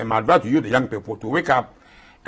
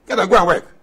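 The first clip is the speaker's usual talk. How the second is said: higher and louder, pushing the command; emphatic loud repeat; 7 semitones higher, 7 dB louder, faster than usual. Real, not acted. Elder ordering idle youth to work, loud and emphatic. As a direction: drive the order home with force.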